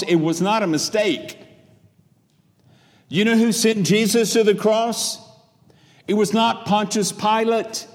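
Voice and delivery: high, loud, building pulpit proclamation; full-force preaching. Real, not acted. Preacher voicing Peter's Pentecost sermon to the congregation, full-force preaching. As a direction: proclaim it with mounting fervor.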